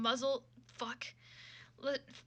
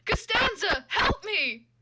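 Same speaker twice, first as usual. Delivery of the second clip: much higher, loud plea for help; desperate cry; 9 semitones higher, 9 dB louder, much slower than usual. Acted. Jerry begging Costanza for help, loud and high. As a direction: yell Costanza's name in panic.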